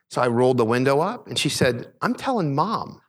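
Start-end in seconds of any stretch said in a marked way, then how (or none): 2.0-3.0 high, slow mock child's threat; tattling daughter. Daughter warning dad, high drawn-out tattle. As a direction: draw it out like a tattling kid.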